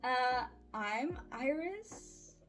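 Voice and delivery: ordinary talk, nothing marked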